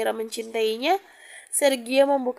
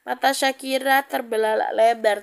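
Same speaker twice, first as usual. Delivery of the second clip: usual speaking voice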